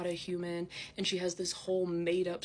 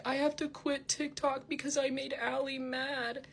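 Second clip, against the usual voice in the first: high, whiny mock-tearful voice; mock sob story; 7 semitones higher than usual. Imitating her quitting TikTok over Allie, mock sob story. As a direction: mockingly imitate a self-pitying complaint. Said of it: "she makes up this sob story".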